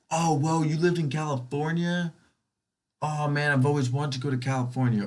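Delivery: mock impressed. Stranger gushing on hearing someone lived in California, mock impressed. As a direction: gush like an impressed new acquaintance.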